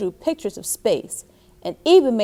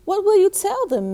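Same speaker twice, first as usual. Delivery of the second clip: mother's voice; 10 semitones higher than usual. Acted. Mother voiced in read story.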